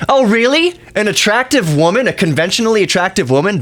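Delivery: high-pitched sarcastic disbelief; mock incredulity. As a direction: question it with exaggerated, high-pitched astonishment. Mocks a commenter's fetish claim.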